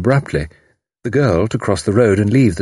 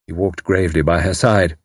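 ordinary delivery, nothing marked

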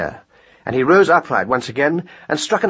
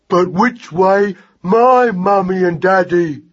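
put-on high, very slow childlike voice; simple young mouse; 8 semitones higher, 4 dB louder, slower than usual. Lost mouse asks hare the way, slow and simple. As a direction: ask doggedly, drawn out and high. Magnus figure.

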